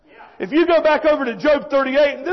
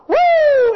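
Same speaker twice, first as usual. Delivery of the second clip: loud, high sustained whoop; high whooping "woo"; 13 semitones higher, 6 dB louder than usual. Retold or imitated. Preacher imitates last night's excited congregation, whooping. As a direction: let out a revival-meeting whoop. Imitates shouting at church service.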